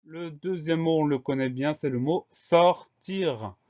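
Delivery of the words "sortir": "sortir" split into two loud, drawn-out syllables; teacher spacing out "sortir" for spelling. Shown as a demonstration. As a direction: dictate "sortir" slowly, one syllable at a time. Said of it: "on a trois syllabes".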